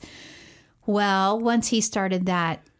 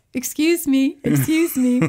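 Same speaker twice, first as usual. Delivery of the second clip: put-on a light toddler voice, higher; imitating toddler; 4 semitones higher, 3 dB louder than usual. Mom imitates son begging to get attention, mock toddler voice. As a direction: mimic a small boy asking politely. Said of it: "he goes".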